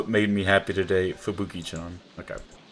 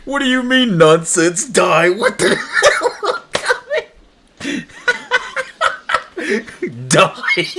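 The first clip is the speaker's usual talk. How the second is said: high, loud incredulous yelps breaking into laughter; shocked laughing disbelief; 14 semitones higher, 7 dB louder, slower than usual. Real, not acted. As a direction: gamer reacts to a shocking in-game text, laughing disbelief. Shocked by the game's reply.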